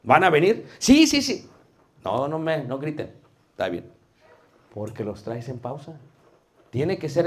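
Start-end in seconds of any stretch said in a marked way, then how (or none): 0.8-1.5 much higher, excited mock yes; eager kids. Imitating children shouting yes to the teacher, excited.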